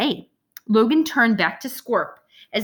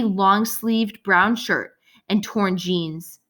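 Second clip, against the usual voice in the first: plain reading voice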